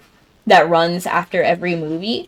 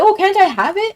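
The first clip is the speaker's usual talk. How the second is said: much higher, wheedling mock plea; imitating the server begging for pizza; pleading server; 10 semitones higher than usual. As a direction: beg for the pizza like a whiny kid. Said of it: "And she was like".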